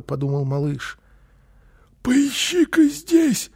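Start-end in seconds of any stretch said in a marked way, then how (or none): 2.0-3.5 very high, strained hoarse crook; Phille the burglar. A narrator's reading. Burglar orders his partner to search, strained and high. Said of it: "сказал Филли".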